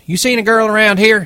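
high, loud, aggressive question; angry demand. A narrator's reading. Curly bursting in after the girl, demanding angrily. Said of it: "he demanded angrily".

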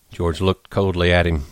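ordinary delivery

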